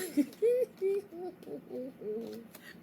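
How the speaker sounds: high giggling laughter; long, high cackling giggle; amused laugh. Real, not acted. Reader tickled by the couple's breakup, amused laughing.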